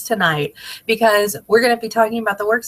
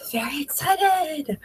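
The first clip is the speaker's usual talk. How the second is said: higher, softer, slow stretched excited; drawn-out excited; 7 semitones higher, 5 dB quieter, slower than usual. Shown as a demonstration. Host to her team, repeating her excitement, higher and slower. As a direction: savor the word excited, slow and lifted.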